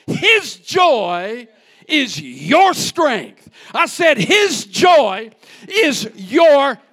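loud, slow, stretched-out proclamation; preacher at full roar; shouted preaching. Real, not acted. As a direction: shout it to the back pews.

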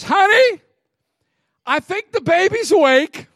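loud high call to the wife; husband hollering to his wife down the hall. Retold or imitated. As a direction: shout it from the other room, pitched high.

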